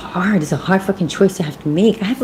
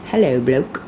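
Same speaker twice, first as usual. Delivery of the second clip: mock British accent; 2 semitones lower than usual. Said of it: "listen to European, um, accents".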